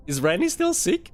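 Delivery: high, lightly voiced school caller; mock school caller. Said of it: "the school rang my dad and was like".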